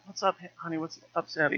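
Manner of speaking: quietly leaning in to ask; father asking his daughter softly over the band; soft close question. Retold or imitated. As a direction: ask gently, close to her ear.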